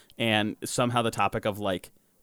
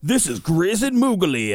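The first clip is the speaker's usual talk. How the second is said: mock introduction; 9 semitones higher, 7 dB louder, slower than usual. Mock grandparent name introduction.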